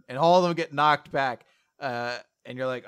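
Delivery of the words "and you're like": usual speaking voice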